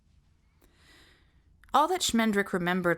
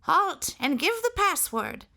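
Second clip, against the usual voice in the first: very high, trembling call of a hidden sentry; 12 semitones higher, 4 dB louder than usual. A narrator's reading. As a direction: quaver it out high from the dark. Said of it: "A high, trembling voice called out".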